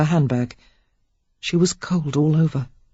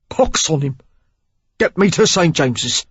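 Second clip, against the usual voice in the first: a wounded Cockney rough, pushing through pain; gruff Cockney. A narrator's reading. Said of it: "with a Cockney twang".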